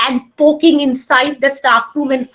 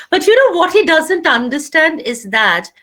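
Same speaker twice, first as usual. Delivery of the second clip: usual speaking voice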